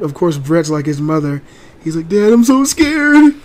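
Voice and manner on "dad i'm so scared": high, loud, drawn-out whimpering; mock scared kid. Dad imitating frightened son, high and whiny. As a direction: ham up a terrified little boy.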